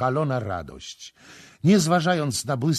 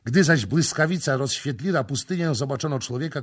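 plain reading voice

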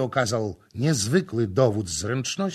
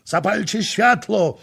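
high, loud order; young commanding shout; 10 semitones higher, 8 dB louder than usual. A narrator's reading. Young prince ordering light in the dark hall. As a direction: bark for light. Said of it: "młodzieńczy głos następcy".

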